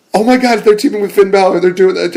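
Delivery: high, fast, thrilled gush; excited. Real, not acted. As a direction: burst out like a fan who can't believe it. Fan reacting to the reunion.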